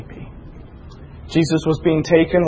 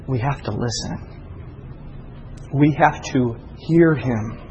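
usual speaking voice

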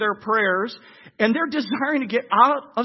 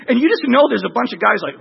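ordinary talk

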